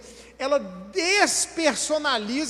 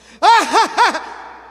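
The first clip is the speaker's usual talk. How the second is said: big, loud, high-pitched laugh; put-on laugh at full volume; loud guffaw; 7 semitones higher, 11 dB louder than usual. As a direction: burst out in an obnoxiously loud laugh. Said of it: "aí ele faz assim, ó".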